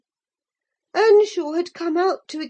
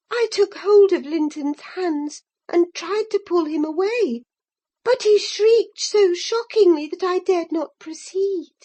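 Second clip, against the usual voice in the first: ordinary delivery, nothing marked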